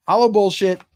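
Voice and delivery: loud, high hashtag shout; hype shout. Real, not acted. Streamer shouting the hashtag to chat, hyped and loud. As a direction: belt it out like a catchphrase. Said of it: "shouting the giveaway hashtag".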